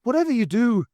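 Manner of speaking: high, loud urgent Irish plea; a pressing Irish cry. Acted. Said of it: "said Pat urgently".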